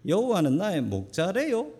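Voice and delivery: high, sing-song Gangwon accent; Gangwon dialect. Shown as a demonstration. Pastor performing a high sing-song Gangwon dialect to amuse the congregation. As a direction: call it out in a high Gangwon lilt. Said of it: "강원도 버전은 이렇답니다".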